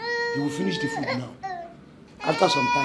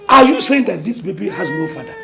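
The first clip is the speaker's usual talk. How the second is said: incredulous challenge; 8 dB louder than usual. Acted. Carer challenges the single-mother claim.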